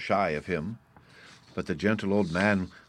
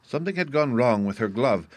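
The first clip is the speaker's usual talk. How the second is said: plain reading voice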